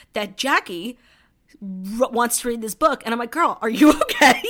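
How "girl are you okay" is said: laughing mock concern. Reacting to friend's reading choice.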